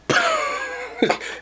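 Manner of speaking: high laugh. Real, not acted. Laughs at tender meat.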